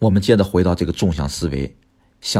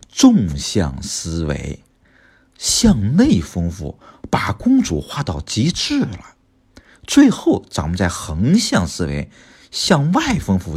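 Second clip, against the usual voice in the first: slow, emphatic key terms; slower than usual. Real, not acted. Spelling out key teaching terms.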